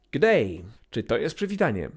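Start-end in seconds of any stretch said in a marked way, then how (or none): 0.1-0.6 Australian accent. Shown as a demonstration. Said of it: "bardzo australijska rzecz".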